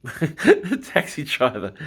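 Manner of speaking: giggling through the words; laughing. Real, not acted. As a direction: laugh as you start. Laughing at a rude joke.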